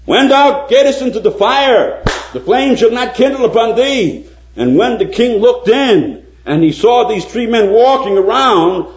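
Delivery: high, loud storytelling sermon; fervent preaching. Acted. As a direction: preach the miracle with rising wonder.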